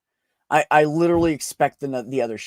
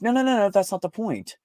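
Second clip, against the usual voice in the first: protesting; 6 semitones higher than usual. Retold or imitated. Acted protest to partner over coffee.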